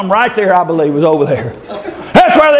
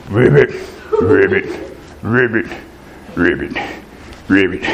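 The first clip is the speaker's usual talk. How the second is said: low, soft crooned ribbits, much lower and softer; sweet frog voice; 6 dB quieter than usual. Retold or imitated. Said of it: "Sounds kinda sweet".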